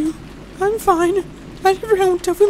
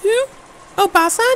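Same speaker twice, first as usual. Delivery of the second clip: plain reading voice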